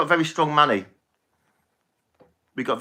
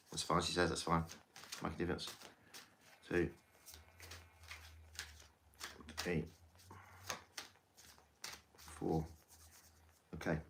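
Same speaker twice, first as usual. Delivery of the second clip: very soft, low muttering while counting; low self-directed murmur while dealing; murmured aside; 7 semitones lower, 20 dB quieter than usual. Real, not acted. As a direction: count under your breath, barely audible. Counting cards to herself while dealing.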